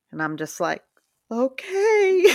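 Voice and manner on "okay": high-pitched mock acceptance with a laugh; reluctant compliance. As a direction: give in, in a comically high voice. Retold reluctant giving in to elder.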